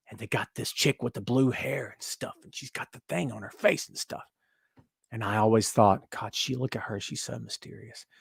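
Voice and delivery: very soft, low murmur; mock hushed awe. Fan recalling a mysterious wrestler, mock hushed awe. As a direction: drop to a hush, feigning intrigue.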